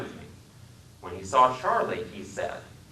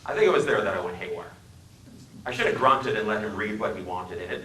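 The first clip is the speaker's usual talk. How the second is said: ordinary delivery, nothing marked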